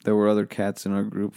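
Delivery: ordinary talk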